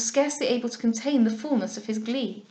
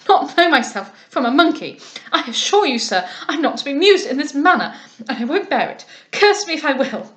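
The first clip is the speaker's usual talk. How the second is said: loud, raised indignant protest; outraged fop; 3 semitones higher, 7 dB louder than usual. A narrator's reading. Lovell refusing to be mocked, loud and indignant. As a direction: bristle with offended pride.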